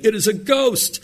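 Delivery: acted alarm, pitched well up; fearful cry. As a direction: read it as the frightened disciples' cry. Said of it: "they cried out for fear".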